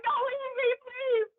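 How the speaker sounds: high, pleading whimper; mock pleading. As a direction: beg the coworker not to go. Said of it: "I was like".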